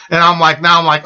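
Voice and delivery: ordinary talk, nothing marked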